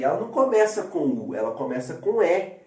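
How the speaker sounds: usual speaking voice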